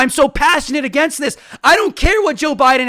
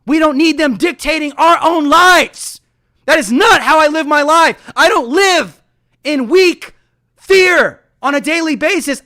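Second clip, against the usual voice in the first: ordinary talk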